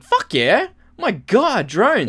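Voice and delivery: high, thrilled outburst; excited. Retold or imitated. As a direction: gush like a kid seeing drones. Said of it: "I'd be like".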